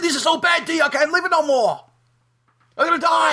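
imitating the drummer gasping his last; mock dying drummer. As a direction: wail it like a melodramatic death. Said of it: "He said".

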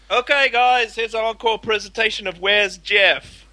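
hammed-up stage introduction; mock children's band presenter. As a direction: announce an encore like a chirpy kids' entertainer. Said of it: "Did they sing like".

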